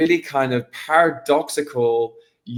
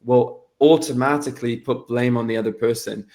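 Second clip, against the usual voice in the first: ordinary delivery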